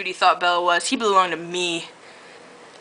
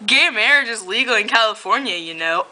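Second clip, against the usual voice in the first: plain reading voice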